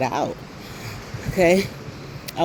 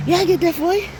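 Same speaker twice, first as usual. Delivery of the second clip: mock boyfriend; 11 semitones higher, faster than usual. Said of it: "boyfriend's easy reply".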